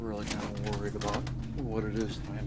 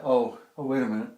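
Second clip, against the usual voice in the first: louder, startled; sudden realization; 3 semitones higher, 7 dB louder than usual. Real, not acted. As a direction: catch yourself suddenly.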